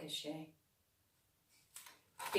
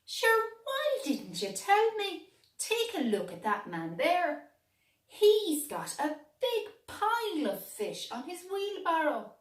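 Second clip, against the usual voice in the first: high, louder eager cat; the cat urging the dog to follow; excited cat; 11 semitones higher, 6 dB louder than usual. A narrator's reading. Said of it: "said Cligging".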